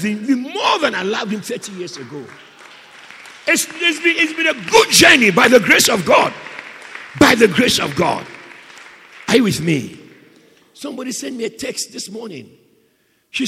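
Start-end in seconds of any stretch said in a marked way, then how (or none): 3.5-10.0 loud, raised preacher's voice; loud, emphatic preaching; full-force preaching. Real, not acted. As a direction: testify loudly to the congregation.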